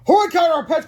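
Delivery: high, loud plea; urgent cry. A narrator's reading. Boy urges on superhero, crying out high. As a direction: cry out for him to hurry. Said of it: "cried Hale".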